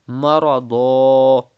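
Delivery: Marada stopped on a long a instead of tanween; pausal alif ending. Shown as a demonstration. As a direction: end marada on a held long a. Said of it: "So you have to read marada".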